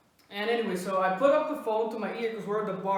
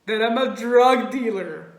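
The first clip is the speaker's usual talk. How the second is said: loud, breaking into laughter; laughing punchline; 7 dB louder than usual. Real, not acted. Teller revealing the accusation, laughing loudly. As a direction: barely hold in a real laugh. Punchline told while cracking up.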